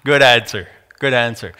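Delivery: amused, knowing approval; imitating a wryly pleased God; wry smirk. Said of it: "with his wry kind of smirk".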